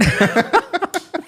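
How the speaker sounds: sudden high-pitched laugh; burst of laughter. Real, not acted. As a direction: break into a high, helpless laugh. Bursts out laughing at own story.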